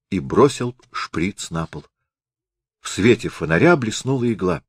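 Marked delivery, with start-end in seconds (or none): none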